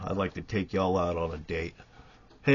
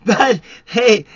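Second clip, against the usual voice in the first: cackling laugh; 13 semitones higher, 12 dB louder than usual. Real, not acted. Cackles mid-rant at viewers.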